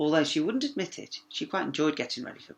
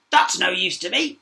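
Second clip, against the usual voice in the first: higher, louder croaky frog; croaking frog; 5 semitones higher, 9 dB louder than usual. A narrator's reading. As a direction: croak it dismissively. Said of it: "croaked the frog".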